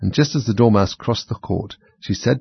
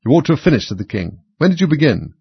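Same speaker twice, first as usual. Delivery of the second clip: stern king; 6 semitones higher, 3 dB louder than usual. A narrator's reading. King questions Hatter.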